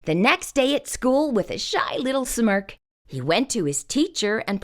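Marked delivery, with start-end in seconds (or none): none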